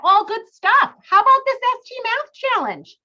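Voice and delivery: excited. Real, not acted. Hyping the challenge to students.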